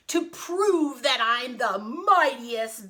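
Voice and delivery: loud, slow, boastful bellow; drawn-out giant boom. Acted. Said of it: "bellowed the giant".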